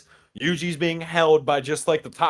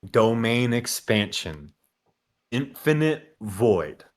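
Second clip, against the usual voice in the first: slow, low reverent callout; name the move with gravity; lightly hammed anime callout; dramatic announcement; 3 semitones lower, much slower than usual.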